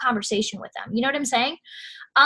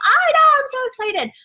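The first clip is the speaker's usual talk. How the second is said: high, loud excited squeal; super excited; 11 semitones higher, 6 dB louder than usual. Retold or imitated. Seller texting back a thrilled customer, high excited squeal. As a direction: gush right back at her. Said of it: "I'm always, like, super excited".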